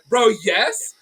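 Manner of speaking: high, loud eager yes; excited yes. Retold or imitated. Answering the friend's offer, eager high yes. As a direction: jump at the offer. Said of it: "I was so excited".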